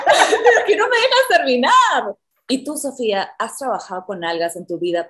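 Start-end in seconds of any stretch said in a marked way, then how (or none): 0.0-2.2 high, loud laughing protest. Real, not acted. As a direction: laugh through the complaint. Laughing protest at being cut off.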